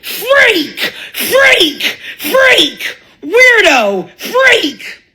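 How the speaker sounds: repeated shrill, high-pitched taunts; mock yelling. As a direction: yell at the kid like a schoolyard mob. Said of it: "and yell".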